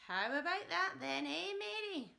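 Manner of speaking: put-on loud cackling old grandma voice calling down; Grandma shouting. Grandma on the rooftop yells down at Mary, loud old-woman shout. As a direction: holler it down from the roof like a wild old granny. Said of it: "Grandma shouted".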